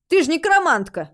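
high, loud, pleased; gleeful pointing-out. A narrator's reading. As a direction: crow it happily and loudly. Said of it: "обрадовался бородач".

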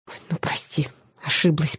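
soft near-whisper, wincing; wincing apology. A narrator's reading. Maya apologises to the demon, soft wincing whisper. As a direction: wince and mumble it softly. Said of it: "поморщилась я".

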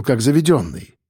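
usual speaking voice

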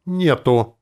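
high, loud gleeful shout; jolly, punched one-word yell; cheerful yell. A narrator's reading. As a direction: holler it merrily. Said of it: "весело заорал друид".

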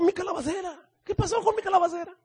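high, fretful wail with a laugh in it; mock despair over a dead plant; worried Jonah. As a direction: Jonah upset over his gourd, high and whiny. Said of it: "el Jonás todo preocupado".

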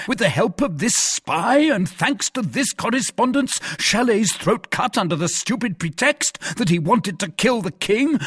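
high-pitched, mounting outrage; affronted, emphatic rant; indignant tirade. Acted. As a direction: rattle off grievances in theatrical disbelief. Porthos's indignant tirade at Aramis.

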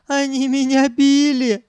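high, whining sob; sobbing. A narrator's reading. Sanyok telling he was beaten, sobbing. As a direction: whimper it in a high tearful voice. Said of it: "всхлипнул он".

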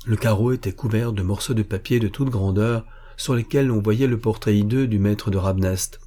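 usual speaking voice

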